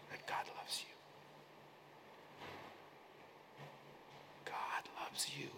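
soft unvoiced whisper. Real, not acted. Preacher whispering assurance to the congregation. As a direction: breathe it gently.

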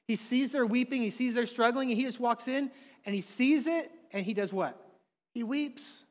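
full-force preaching. Acted. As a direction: drive it home from the pulpit.

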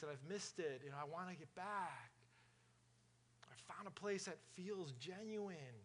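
soft, lightly mocking voice of a longing churchgoer; put-on churchgoer voice. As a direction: quietly gush about the community in a lightly put-on voice. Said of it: "I don't know what accent or what voice this is".

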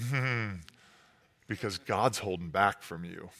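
low, soft, sly hush; crafty snake. Acted. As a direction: draw them in like a scheming serpent. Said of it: "more crafty than all the other animals".

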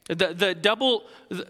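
ordinary talk, nothing marked

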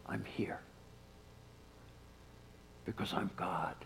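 tender whisper. Acted. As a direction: whisper it as God consoling someone in tears. Jesus' comforting words, whispered.

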